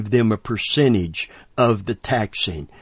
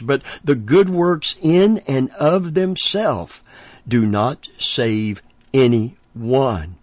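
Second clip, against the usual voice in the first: slow hammered pulpit point; preaching emphasis; slower than usual. Real, not acted. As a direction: drop slowly on "do not save anyone". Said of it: "preaching emphasis".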